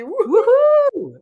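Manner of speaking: big high whoop of excitement; loud joyful woohoo; excited cheer. Real, not acted. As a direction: whoop it up to welcome her. Cheering the guest's arrival.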